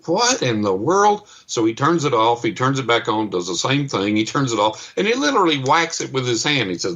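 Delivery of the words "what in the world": high, slow puzzled exclamation; baffled. Retold or imitated. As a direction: drag it out, disbelieving. Gas man baffled by meter.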